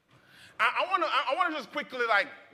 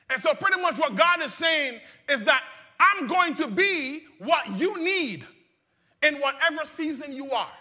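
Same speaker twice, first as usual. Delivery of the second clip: loud, high, building preacher's cry; preaching at full force; 7 semitones higher, 7 dB louder than usual. Acted. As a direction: preach it, lifting the congregation.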